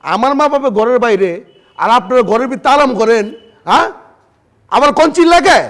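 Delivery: loud, furious retort at the door; angry shouting. Acted. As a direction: snap back at her, loud and indignant. Angry son confronts sister-in-law.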